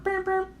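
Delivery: high chirpy beep beep like a car lock; car beep imitation. Imitating his girlfriend's car lock as she gets home, high chirpy beeps.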